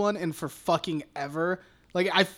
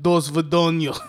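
mock Russian phrase, lower than usual; imitated Russian accent; 4 semitones lower than usual. Podcaster imitating Alex watching the movie, mock Russian accent. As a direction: imitate a friend's bad Russian. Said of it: "That's Russian, Alex".

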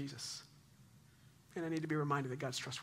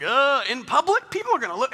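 worried hesitation; 9 semitones higher, 13 dB louder than usual. Retold or imitated. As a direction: play a nervous dad, higher and louder. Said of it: "And I'm like".

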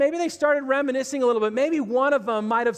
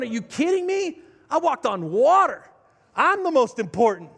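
a hint of an offended Peter bragging; boastful Peter; 3 semitones higher, 3 dB louder than usual. Peter boasting to John about walking on water, mock indignant.

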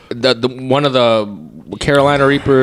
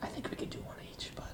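whispering; 9 semitones lower, 27 dB quieter, much faster than usual. Real, not acted. Quiet aside at the table.

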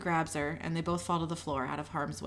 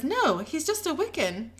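higher-pitched, earnest correction; defensive protest; 7 semitones higher than usual. A narrator's reading. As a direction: correct them in a high, earnest voice. Becky defends Guy to Sam.

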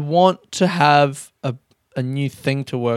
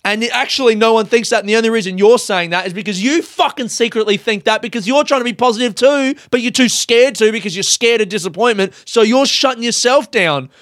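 angry rant; 8 semitones higher, 3 dB louder than usual. Real, not acted. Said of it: "clearly I'm angry".

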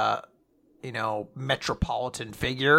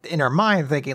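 normal talking voice

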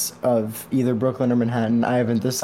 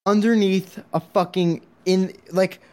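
stressed retelling; 6 semitones higher than usual. Real, not acted. Stressed subway story.

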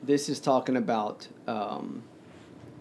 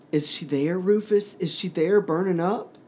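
higher, rising, urgent questions; urgent questioning; 6 semitones higher, 4 dB louder than usual. A narrator's reading. Child begs Rufus about his mother, urgent and high. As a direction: push the pitch up into each question.

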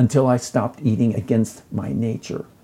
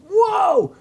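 a sudden, much higher shout of wonder; amazed whoa. Real, not acted. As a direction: let the amazement burst out. Reacting to the lady's story.

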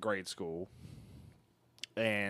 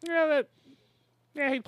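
squeaky excited; 5 dB louder than usual. Real, not acted. Reacting to news.

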